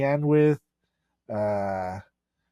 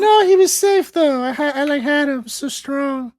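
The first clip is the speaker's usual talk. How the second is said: very high falsetto, proud; [name] voice; 12 semitones higher, 4 dB louder than usual. Pretend.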